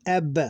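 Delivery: "Ab" as one joined syllable; read together. Shown as a demonstration. Teacher showing two letters read together, "Ab" as one syllable. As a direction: say "Ab" in one beat. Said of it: "these two have to be read together".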